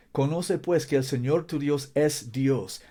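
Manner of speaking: ordinary talk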